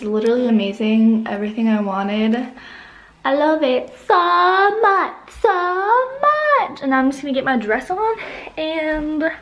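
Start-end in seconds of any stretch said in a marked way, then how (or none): 3.2-6.8 slow, much higher swooning delight; gushing. Real, not acted. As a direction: swoon over it, drawn out. Gushing over her hair.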